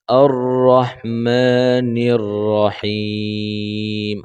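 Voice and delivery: রহমানির রহিম with a light thin হা. Shown as a demonstration. Teacher showing the thin হা sound. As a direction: keep হা thin in রহিম, slow. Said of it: "চিকন করে পড়বো".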